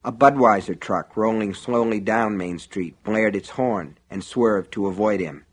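ordinary delivery

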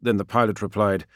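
plain reading voice

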